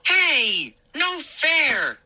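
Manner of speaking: indignant protest. A narrator's reading. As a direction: protest like a sore loser. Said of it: "he declared".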